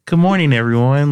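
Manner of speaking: mock newscaster greeting; imitating reporter voice. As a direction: parody the anchor's hello. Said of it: "It was".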